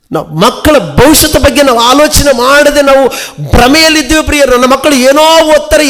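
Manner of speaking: high, loud pulpit warning; fiery preaching. Acted. Pastor warning parents about their children, preaching at full force. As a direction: thunder it at the congregation like a revival preacher.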